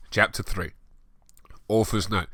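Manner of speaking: ordinary delivery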